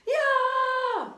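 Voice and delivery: high, loud shriek; Leslie scream. A narrator's reading. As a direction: scream as your pigtail is yanked. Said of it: "Leslie screamed".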